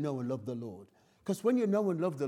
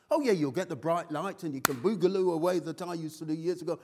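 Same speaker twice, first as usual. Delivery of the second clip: ordinary talk, nothing marked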